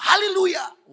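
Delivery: high, very loud, drawn-out hallelujah; preaching shout. Retold or imitated. Preacher to congregation through an interpreter, exultant shout. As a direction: belt it out like a revival preacher.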